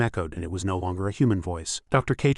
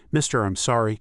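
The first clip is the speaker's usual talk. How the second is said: ordinary delivery, nothing marked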